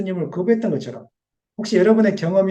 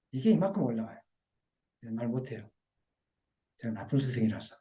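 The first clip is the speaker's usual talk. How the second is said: much softer, lower, rueful; hushed confession; 6 semitones lower, 10 dB quieter than usual. Real, not acted. Pastor confessing to the congregation he is a bad teacher, hushed. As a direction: admit it almost under the breath.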